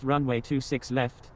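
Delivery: normal talking voice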